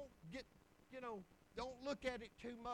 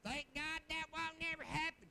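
higher, put-on wife's voice; mock wife voice; 5 semitones higher than usual. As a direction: imitate the wife in an exaggerated high voice. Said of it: "I'm gonna talk like that".

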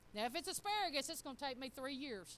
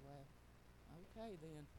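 softer, lower, sheepish; deflated; 9 semitones lower, 13 dB quieter than usual. Retold or imitated. Mom caught out by her son, softly sheepish. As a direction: back down quietly, caught out.

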